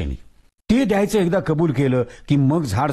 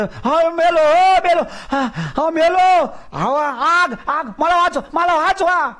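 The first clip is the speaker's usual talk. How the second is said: ghost screaming; slower than usual. Acted. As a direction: shriek for rescue. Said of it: "भूत किंकाळी फोडून म्हणालं".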